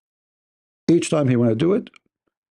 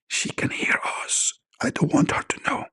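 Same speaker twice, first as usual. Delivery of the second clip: unvoiced secret whisper; 10 semitones higher, 5 dB quieter than usual. Caller to angel, whispering secretly. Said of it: "That is not working".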